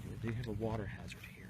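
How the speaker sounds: soft unvoiced aside; whispered commentary; hushed golf whisper.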